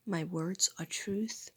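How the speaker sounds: slow whispered "My words are truth"; hushed repeated mantra; whispered affirmation. Real, not acted.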